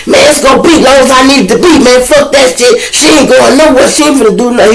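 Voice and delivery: high, loud, fast defiant threat; threatening inmate. Retold or imitated. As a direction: snarl it like he owns the room. Inmate threatening, holding the woman.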